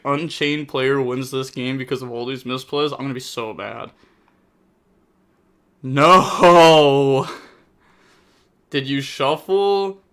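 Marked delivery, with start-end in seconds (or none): 5.8-7.3 loud dismayed no. Real, not acted. Reacts to opponent's draw.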